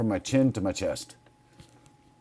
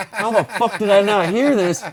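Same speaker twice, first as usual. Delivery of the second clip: startled disbelief; 8 semitones higher, 5 dB louder than usual. Retold or imitated. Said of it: "I'm like".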